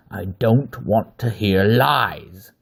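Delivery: a beast snarling through pain it causes; slow loud snarl. Acted. Wolf man snarls at captive Aver, slow and loud. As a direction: drag each word out, loud and menacing. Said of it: "it snarled".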